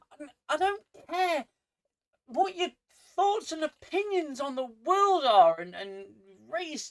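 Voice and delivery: high-pitched, exasperated angry rant; staying angry. Real, not acted. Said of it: "apparently I'm staying angry".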